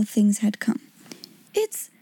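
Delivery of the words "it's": high, halting dread; choked fear. A narrator's reading. Becky hearing Miss Minchin on the stairs, choked fear. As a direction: choke it out high, broken by a pause. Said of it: "choked Becky".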